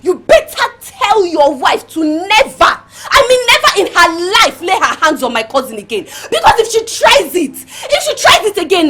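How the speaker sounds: heated, emphatic ultimatum; angry warning. Acted. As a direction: warn forcefully, pitch climbing toward the end. Threatening warning to the husband.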